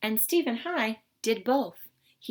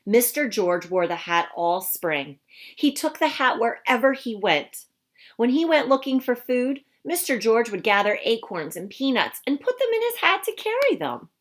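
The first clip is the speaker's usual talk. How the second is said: lively, expressive storybook voice; reading to children; 2 semitones higher, 7 dB louder than usual. Acted. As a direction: read it to a class of young kids. Reads picture book to children.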